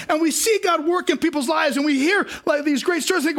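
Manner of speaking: normal talking voice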